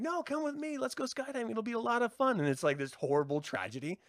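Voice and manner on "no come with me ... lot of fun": much higher, eager friend voice; mock cheerful invite. Imitating the friend inviting everyone skydiving, much higher and eager. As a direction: sell the jump brightly. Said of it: "You're like".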